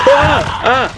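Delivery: sharp, high startled screams; panicked scream. Real, not acted. Gamer jumped by an infected, real startled screams. Jump scare while playing.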